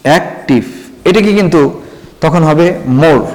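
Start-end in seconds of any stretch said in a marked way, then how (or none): 0.1-0.6 অ্যাক্টিভ in two separate beats, অ্যাক্–টিভ; split into syllables. Shown as a demonstration. Teacher showing students the syllables, অ্যাক্টিভ split in two. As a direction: break অ্যাক্টিভ after অ্যাক্, then say টিভ. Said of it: "কয়টা syllable এখানে?".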